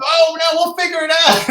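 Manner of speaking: high, hammed-up alarm; mock panic. Guides' joking panic continues.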